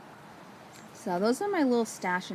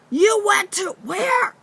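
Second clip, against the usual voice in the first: higher, louder, slow, stunned yell; shocked shout; 13 semitones higher, 9 dB louder, much slower than usual. Retold or imitated. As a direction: bellow it in disbelief. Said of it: "And he's like".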